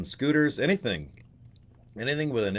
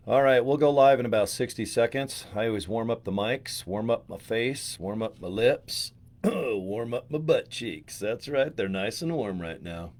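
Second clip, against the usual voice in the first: ordinary talk, nothing marked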